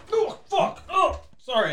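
hammed-up leftover rage; mock mad. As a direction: blurt it like the anger is still boiling. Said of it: "He's still mad about it".

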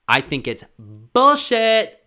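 high, loud, slow indignant rant; furious fan ranting; exasperated outburst. Real, not acted. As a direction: spit out the verdict. Ranting about disqualifications.

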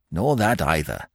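ordinary delivery

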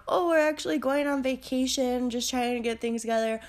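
normal talking voice

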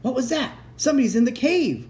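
high-pitched startled exclamation; alarmed storybook voice, pitched high. A narrator's reading. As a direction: call out in alarm at a sudden noise.

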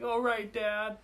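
put-on high-pitched small boy answering his dad; little kid. Four-year-old answering his dad on the way to the bathroom, little kid voice. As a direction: play an eager little kid. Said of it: "I hear this kid and his dad".

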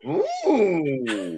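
high, drawn-out "ooh" sliding down; long falling ooh. Real, not acted. Co-host reacting to a cheeky rating, drawn-out ooh. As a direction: let a high ooh glide slowly downward.

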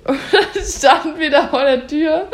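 höher und lauter, durch Lachen hindurch, langsam; lachend erzählt. Real, not acted. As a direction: sich vor Lachen kaum halten. Erzählt lachend die peinliche Szene. (lachend und langsam erzählt)

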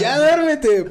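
very high, loud exasperated shout; mock angry yell. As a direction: yell "duérmete" as you swing the pillow. Hits friend with pillow. (grito exasperado)

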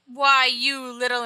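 loud, slow mock fury; hammed-up indignant yell; angry outburst. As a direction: sputter it at the fleeing kid. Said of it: "He's all".

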